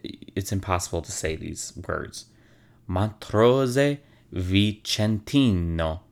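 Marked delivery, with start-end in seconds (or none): 2.9-6.0 slow, rolled Italian accent on "Montrose Vicentino"; "Montrose Vicentino" in a drawn-out Italian accent; best Italian accent. Shown as a demonstration. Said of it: "in my best Italian accent".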